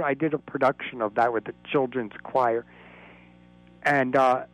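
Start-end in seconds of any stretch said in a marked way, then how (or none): none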